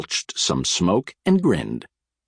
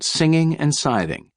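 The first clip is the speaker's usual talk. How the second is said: usual speaking voice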